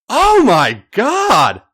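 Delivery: high, loud and very slow; slow loud cry of release; drawn-out ecstatic cry. Acted. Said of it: "he breathed".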